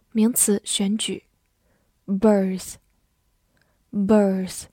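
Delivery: ordinary talk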